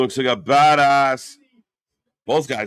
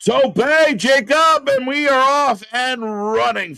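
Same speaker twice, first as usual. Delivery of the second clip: mock announcer call; 11 semitones higher, 6 dB louder than usual. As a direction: call the bell like a hyped TV announcer. Mock announcer calling match start.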